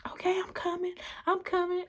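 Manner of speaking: high-pitched mimicked reply; imitating a hurried friend. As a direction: answer high, as the flustered friend. Friend answers the urgent call.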